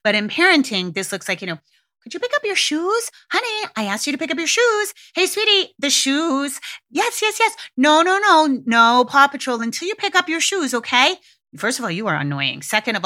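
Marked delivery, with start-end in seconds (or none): 2.0-11.2 put-on high, sing-song parent coaxing a child; mock sweet parent. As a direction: sweet-talk the kid into picking up shoes, voice way up. Parent pleading with child over shoes.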